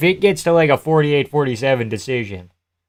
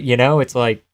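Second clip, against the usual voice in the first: ordinary talk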